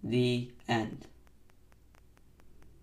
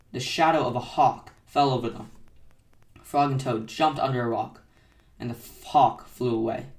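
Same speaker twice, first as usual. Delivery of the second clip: usual speaking voice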